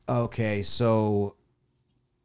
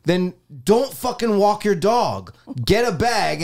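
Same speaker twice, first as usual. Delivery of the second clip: angry yelling; 7 semitones higher than usual. Retold or imitated. Yelling at the dog owner about the bag, angry. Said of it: "I'm like".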